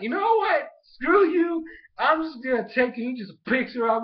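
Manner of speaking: high, loud exasperated yell; a character losing patience with the grandma, high and loud; heated outburst. Retold or imitated. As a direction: blow up in mock frustration. Quoted character's heated retort.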